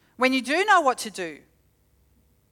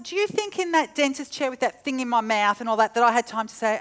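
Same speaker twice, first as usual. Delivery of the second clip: ordinary delivery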